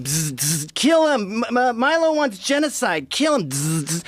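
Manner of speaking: high buzzing robot voice, glitching; malfunctioning robot. Pretend. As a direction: play a broken robot shouting. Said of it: "they're malfunctioning robots".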